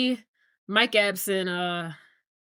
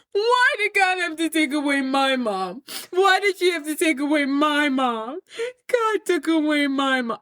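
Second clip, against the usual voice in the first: acted tearful wail; 4 semitones higher than usual. Grieving son asks why his mom was taken, acted tearful wail. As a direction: imitate anguish, stretching each phrase toward tears. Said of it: "his anger quickly transitions into tears".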